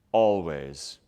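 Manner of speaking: a hint of a deep solemn movie line, no British accent; quoting the Potter line to a passing runner, lightly low and solemn; low Snape-style Always. Runner answers a stranger with a low mock-solemn Always. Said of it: "Not in a British accent".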